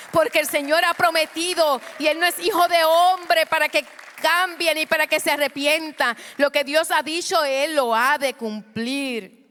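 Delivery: loud, high, rising preacher's cry; preaching at full force. Acted. Preacher urging the church to give it to the Lord, shouting.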